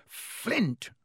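high sharp call; bellowed. A narrator's reading. Wood calling the Slytherin captain, high bellow. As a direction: bark the name across the field. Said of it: "Wood bellowed".

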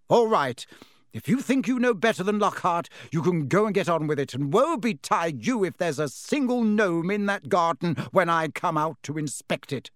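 put-on mother sending the boys out to degnome; sharp scolding. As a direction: put them in their place, fast. Mrs Weasley scolding the boys.

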